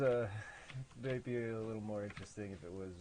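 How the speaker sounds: ordinary delivery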